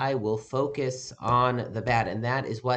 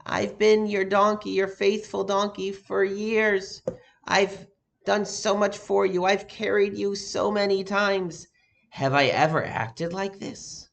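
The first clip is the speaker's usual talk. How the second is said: a lightly voiced, higher and slower pleading donkey; 7 semitones higher, 3 dB louder, slower than usual. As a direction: plead gently like a hurt, loyal animal.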